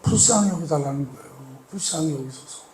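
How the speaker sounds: pleading prayer. Acted. Acted plea in prayer.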